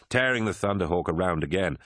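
usual speaking voice

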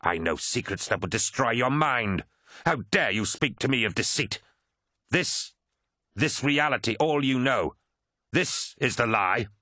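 indignation rising in pitch; calm slipping. A narrator's reading. Erebus confronts the first captain, calm slipping into anger. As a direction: let composure give way to offended fervour. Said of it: "his mask of smirking calm slipping".

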